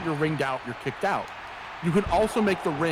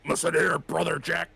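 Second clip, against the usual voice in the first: a lightly lowered, bassy wrestler growl; bassy Hulk Hogan voice. Retold or imitated. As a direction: mimic the real bassy Hogan delivery. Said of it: "like, the real bassy".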